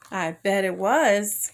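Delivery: ordinary delivery, nothing marked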